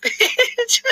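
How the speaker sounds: high laughing bitch. Real, not acted. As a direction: laugh through the word. Teasing friend.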